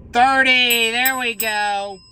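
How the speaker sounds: high, loud burst of excitement; excited shout. Real, not acted. Player seeing a match on the ticket, excited shout. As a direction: call it out, thrilled at the match.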